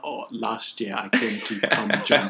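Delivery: slow, loud put-on African accent. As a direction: answer deliberately in a mock African accent. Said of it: "African accent".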